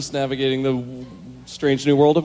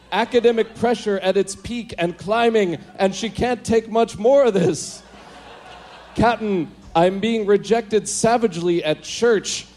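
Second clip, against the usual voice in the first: high-pitched, panicked attempt at Scotty's accent; bad Scotty accent; 10 semitones higher, slower than usual. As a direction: alert the captain in a badly faked Scotty accent. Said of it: "I can't do a Scotty accent".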